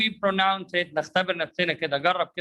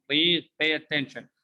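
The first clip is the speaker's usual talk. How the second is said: normal talking voice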